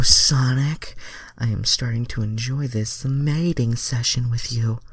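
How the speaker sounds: high, breathy heroine voice; Starfire voice. Acted. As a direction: keep it high and dreamy. Starfire's line in fanfic.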